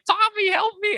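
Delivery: put-on squeaky high toddler plea; high little-boy call for help; baby voice. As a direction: beg for help like a tiny kid. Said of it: "he's like".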